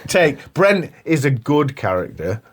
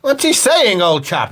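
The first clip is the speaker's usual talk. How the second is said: high mock English chap, dropping at the end; put-on English chap voice; English chap accent; 5 semitones higher, slower than usual. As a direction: ask as a caricature English old chap. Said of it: "the English guys are like".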